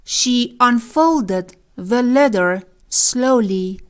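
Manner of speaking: very slow, each word spaced for learners; slow, careful teacher reading; slow model sentence. Shown as a demonstration. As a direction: read it out slowly like a teacher. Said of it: "مثال".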